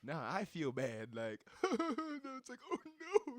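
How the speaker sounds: high, loud, stunned; a friend stunned at the news, high and loud; shocked disbelief. Real, not acted. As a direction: blurt it out in shock.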